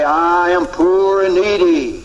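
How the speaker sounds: slow, humble plea; humble confession. Retold or imitated. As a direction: say it slowly like a poor beggar. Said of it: "You come and say".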